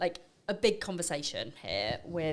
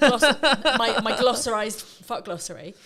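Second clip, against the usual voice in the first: laughing; 4 semitones higher, 12 dB louder than usual. Real, not acted. Laughing at her own word.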